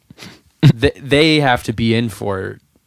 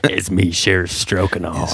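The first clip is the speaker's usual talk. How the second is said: low lightly drawled cowboy; a hint of a sultry cowboy; sexy cowboy voice; 4 semitones lower, 3 dB quieter than usual. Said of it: "in a sexy cowboy voice".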